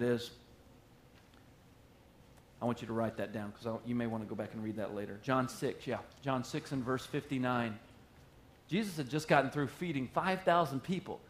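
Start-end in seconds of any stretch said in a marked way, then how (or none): none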